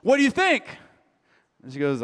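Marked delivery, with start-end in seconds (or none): 0.0-0.6 high, loud, fishing for a compliment; husband eager for praise from wife; eager asking. Retold or imitated. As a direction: show off the new look. Husband showing off trimmed beard.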